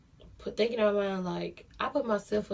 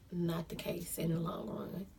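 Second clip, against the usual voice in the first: slow, whispered afterthought; whispered aside; 7 dB quieter, slower than usual. Real, not acted. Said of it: "whispered aside".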